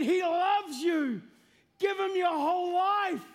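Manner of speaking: high, loud, drawn-out mock-crying appeal; imitated tearful pleading. Second video sent to the mocking man, re-enacted tearful pleading. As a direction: plead it as if through tears, stretching the words. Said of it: "This time I'm in tears, crying, saying".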